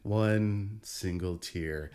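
milk "One single tear" like a tragic movie moment; slow dramatic hush. Shown as a demonstration. Mock-dramatic repeated phrase.

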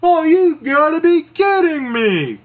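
hammed-up panic; shocked Mean Gene. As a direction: squeal in horror at the idea. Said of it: "Gene is like".

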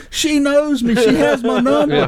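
high, insistent mock protest; retold calling out. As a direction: protest over your shoulder as you're dragged off. Calling out while being dragged away.

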